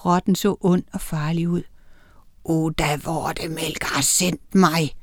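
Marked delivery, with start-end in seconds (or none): 2.4-5.0 low, slow menacing creature voice; hissing rat. A narrator's reading. Evil giant rat tells who sent it, hissing. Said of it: "hvæsede den".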